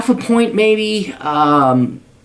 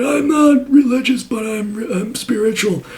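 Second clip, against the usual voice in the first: mocking hippie guru; 7 semitones higher, slower than usual. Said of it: "He said".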